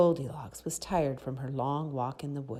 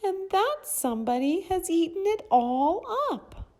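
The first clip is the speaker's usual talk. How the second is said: tiny, very high little bear voice; high, loud, cute cub cry; itty-bitty Baby Bear; 8 dB louder than usual. A narrator's reading. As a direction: squeak it out like an upset baby bear. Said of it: "in his itty-bitty voice".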